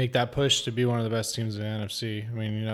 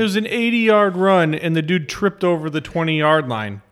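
excited; 6 semitones higher, 8 dB louder than usual. Real, not acted. Excited retelling of run.

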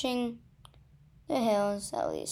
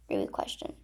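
ordinary delivery

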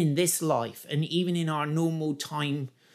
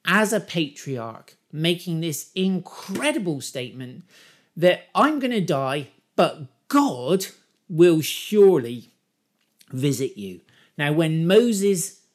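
rising preacher fervour; 3 semitones higher, 5 dB louder than usual. Acted. Preaching build on Joseph's words.

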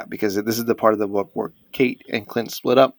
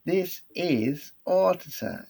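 slow, higher British announcer voice; British accent; 7 semitones higher, 4 dB quieter, much slower than usual. Shown as a demonstration. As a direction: drawl it like a British TV host. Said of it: "say it with a British accent".

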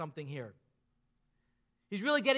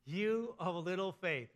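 drawn out slowly, softer towards the end; slow rhetorical question; 3 semitones lower, 4 dB quieter, slower than usual. Retold or imitated. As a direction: lay the question down slowly and gently. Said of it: "He says".

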